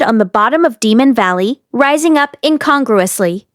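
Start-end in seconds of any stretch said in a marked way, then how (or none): none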